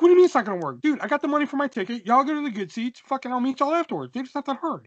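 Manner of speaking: high, indignant rant; exasperated arguing. Retold or imitated. Teen arguing with buddy over tickets, exasperated.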